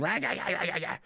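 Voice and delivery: mock commentator yammer, garbled and rapid-fire; babbling sportscaster parody, held back in volume; Crofty impression. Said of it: "so I can hear Crofty going".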